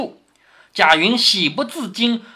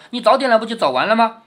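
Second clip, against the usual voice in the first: ordinary delivery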